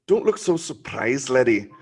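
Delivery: slow, loud Scottish brogue; hammed-up Scottish caption read; Scottish accent. Shown as a demonstration. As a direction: give it your broadest Scottish accent. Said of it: "in your, uh, Scottish, uh, accent".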